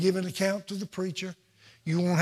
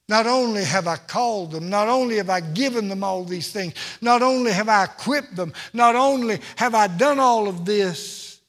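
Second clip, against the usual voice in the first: louder with each not only, stress on only; building preacher litany; 2 semitones higher, 5 dB louder than usual. Acted.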